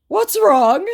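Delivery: high, loud mock bewilderment; clueless scammer. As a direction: ask as a baffled scammer. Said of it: "Completely not understanding what was happening".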